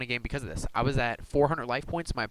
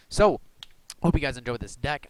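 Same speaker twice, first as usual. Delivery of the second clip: ordinary delivery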